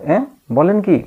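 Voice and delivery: high, surprised exclamation; a touch of shock, as if not believing it; startled question. Retold or imitated. Amar hearing the police are coming, startled and high.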